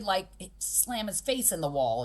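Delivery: usual speaking voice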